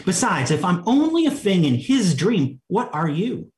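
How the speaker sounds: indignant. A narrator's reading. Alice challenges the twins about being a dream thing, indignant. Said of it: "said Alice indignantly".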